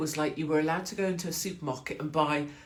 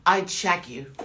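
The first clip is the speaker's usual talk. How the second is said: mock lad's line; 5 dB louder, slower than usual. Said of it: "boys say that all the time".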